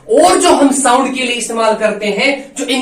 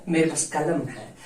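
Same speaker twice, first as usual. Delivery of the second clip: quiet film-line reply; 6 semitones lower, 11 dB quieter, faster than usual. Retold or imitated. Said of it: "तेरे पास क्या है?".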